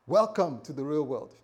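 ordinary talk

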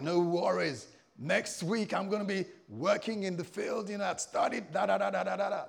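high, loud, cocksure rattle; breezy confidence. Retold or imitated. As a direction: act out a young graduate's sure plans. Acts out his confident younger self.

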